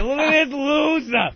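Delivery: high, drawn-out jeering yell; mock screaming taunt. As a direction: scream mockery like an obnoxious clown. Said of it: "he's just screaming at him".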